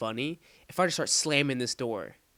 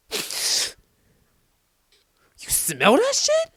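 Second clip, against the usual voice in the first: high, loud, drawn-out incredulous imitation; [name], shocked disbelief; 9 semitones higher, 9 dB louder than usual. Buddy noticing the stink in the locker room, mock incredulous high shout. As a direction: squawk it in hammed-up disbelief.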